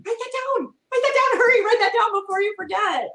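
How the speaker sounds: quick high-pitched urging; urgent prompting. Acted. Teacher urging a kid to catch the phrase, urgent and high. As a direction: jump in urgently, pitched well up.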